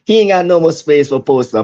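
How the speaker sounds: ordinary talk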